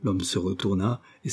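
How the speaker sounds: plain reading voice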